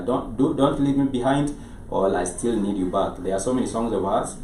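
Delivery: normal talking voice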